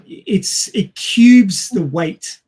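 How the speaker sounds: ordinary delivery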